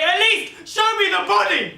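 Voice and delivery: loud, high, slow, desperate; desperate plea. Acted. Mother demanding to see her dead daughter, loud and desperate. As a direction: beg at full voice.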